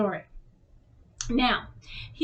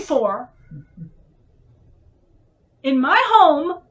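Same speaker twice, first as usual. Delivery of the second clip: louder and higher, furious; angry retort; 5 semitones higher, 7 dB louder than usual. Retold or imitated. Retelling how she told her boyfriend off, furious. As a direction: tell him off from your bed. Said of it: "And I got pissed".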